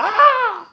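long high holler of pain. A narrator's reading. Husband hit on his feet, hollering in pain. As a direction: holler out as the blow lands. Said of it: "He hollered".

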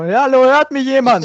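high, loud confused mic-check voice; squeaky lost-in-the-call mic check; imitating faint caller. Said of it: "er einfach".

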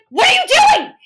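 put-on high, loud shocked yell at the kids; shouting at the kids. Aunt yelling at kids found in the street, loud and high. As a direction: demand it in alarm, loud and high.

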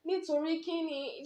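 high, whining Yoruba protest; mock protest. Younger sister whining back at her scolding sister in Yoruba, high. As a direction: whine it back like a wronged kid. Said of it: "I'm like".

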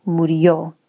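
murió, landing on the final ó; final-syllable stress. Shown as a demonstration. Showing why the Spanish word felt final, murió stressed on ó. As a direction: put the emphasis on the last syllable of murió. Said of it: "emphasis on the word is on the final syllable".

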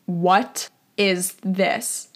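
very slow, word by word, with gaps; slow, stunned question. Retold or imitated. Dad finding a condom on the desk, slow stunned question. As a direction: draw out each word in mock dread discovery. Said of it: "and he goes".

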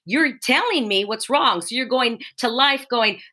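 usual speaking voice

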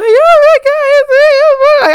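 very high, loud squealed wail; mock ugly crying. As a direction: bawl like an overwhelmed fan. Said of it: "I am just ugly crying".

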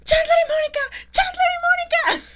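very high, loud shriek of the names; Phoebe screaming. Retold or imitated. Friend imitating Phoebe screaming at the window. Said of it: "empieza a gritar".

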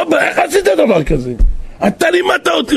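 high, pained reproach to his father; shocked son. Retold or imitated. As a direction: confront your father in disbelief. (בן נדהם, בקול גבוה)

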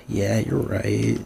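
heavy sigh, then slow low concession; resigned. Retold or imitated. Retelling giving in over the extra money, resigned. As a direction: sigh and give in slowly.

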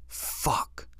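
whispered swear under the breath; whispered curse. Retold or imitated. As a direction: curse to himself, voiceless.

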